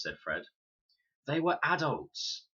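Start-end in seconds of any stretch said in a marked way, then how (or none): 1.3-2.5 slow, higher, disbelieving. A narrator's reading. Con doubting Fred's raft idea, slow and disbelieving. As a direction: scoff that they were grown-ups, slowly. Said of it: "thick with disbelief".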